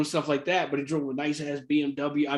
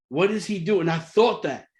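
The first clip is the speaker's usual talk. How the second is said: usual speaking voice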